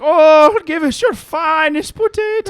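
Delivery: high, loud mock-posh order; high showy bar customer voice; hammed-up potato connoisseur. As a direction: order a potato like fine whiskey. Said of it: "you're like".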